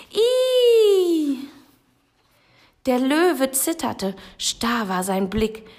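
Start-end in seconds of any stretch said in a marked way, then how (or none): 0.1-1.4 a tiny high squeal instead of a roar; squeaky shriek. Acted. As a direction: let the lion squeal like a frightened mouse. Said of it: "brüllte der Löwe mit Inbrunst". (hohes, schrilles Quieken)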